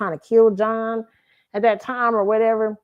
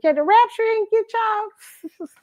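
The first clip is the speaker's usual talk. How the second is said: put-on high, slow sing-song little girl voice; teasing child; slower than usual. Little girl teasing her grandparents, high sing-song. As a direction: taunt the grandparents like a small kid. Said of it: "telling them".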